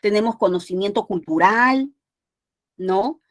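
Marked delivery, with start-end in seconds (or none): none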